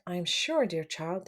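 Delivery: plain reading voice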